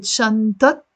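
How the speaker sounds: شنطة ending in -at; standard Arabic T. Shown as a demonstration. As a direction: sound the final T of شنطة after an a. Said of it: "In standard Arabic".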